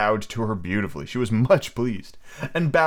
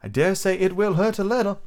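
put-on warning girl voice; 10 semitones higher than usual. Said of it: "she warned him".

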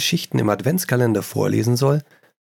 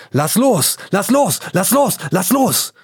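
rising shout; 10 semitones higher, 4 dB louder than usual. Acted. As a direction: voice climbing higher and louder with each repeat. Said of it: "immer lauter, immer schneller". (immer lauter werdendes, hohes Rufen)

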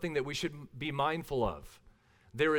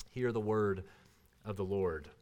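liturgical close; 7 semitones lower than usual. Acted. Preacher ending the scripture reading, low and solemn.

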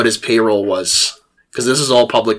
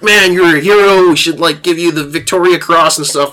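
hammed-up eager officer, higher and louder; excited officer; 7 semitones higher, 5 dB louder than usual. Young officer praising the exhausted soldier, excited and loud. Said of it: "the officer's like".

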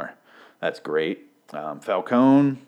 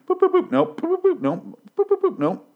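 much higher, squeaky beep-beep-nope; keypad beeps; 4 dB louder than usual. Retold or imitated. Bruce mashing the keypad, squeaky mock beeps. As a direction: chirp the keypad tones, then reject. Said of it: "He just seems like he went".

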